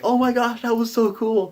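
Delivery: high, gushing squeal of the whole table; very high-pitched retold cheering; mock excited scream. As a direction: imitate players screaming with excitement.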